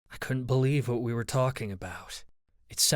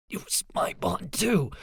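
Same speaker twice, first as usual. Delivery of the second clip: whispered croak rising to a strained, higher too; 6 semitones higher than usual. Acted. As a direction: choke the words out with a hand at your throat. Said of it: "I managed to croak the words".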